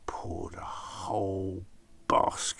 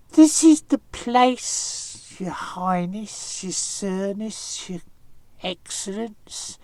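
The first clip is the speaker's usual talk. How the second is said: funny spider voice; 10 semitones higher, 8 dB louder than usual. Acted. Anansi flattering the snake at the cave, high spidery voice. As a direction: squeak it out like a sly little spider. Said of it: "in a funny little spiderish sort of a voice".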